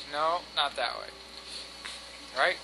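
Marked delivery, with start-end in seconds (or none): none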